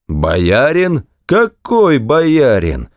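put-on drawn-out, high scoffing from a drunk official; drunken blustering. A narrator's reading. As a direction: narrator voicing a noisy drunk's disbelief. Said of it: "зашумел опять пьяный дьяк".

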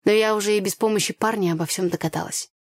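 plain reading voice

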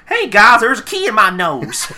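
hammed-up character announcement with a laugh; Applejack. Host mocking Applejack's odd nose, loud high character voice. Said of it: "and then Applejack goes".

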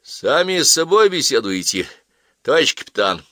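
put-on young cabbie teases the captain; cheeky taxi driver.

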